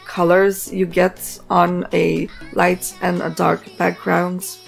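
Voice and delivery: ordinary delivery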